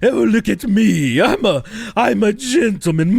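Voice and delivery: high, slow, hammed-up Jeeves-style gentleman; performative posh gentleman. Mocking a performative British gentleman, hammed-up posh. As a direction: show off like a stage butler. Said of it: "it's performative".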